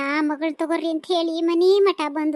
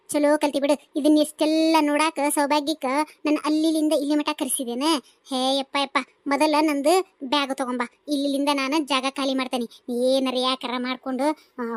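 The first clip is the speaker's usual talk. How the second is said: normal talking voice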